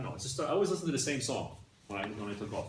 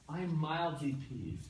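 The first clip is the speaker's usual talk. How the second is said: slow, drawn-out and deliberately mild; understated calm; much slower than usual. Shown as a demonstration. Teacher showing the gentle wording to students, slow understated calm. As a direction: say it slowly, playing it down for a laugh. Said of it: "If you say".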